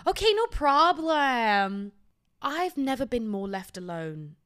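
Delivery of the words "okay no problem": high, loud, fast gushing salesperson; bubbly clerk pressing to help; over-eager American sales patter; pushy cheerful shop assistant. Retold or imitated.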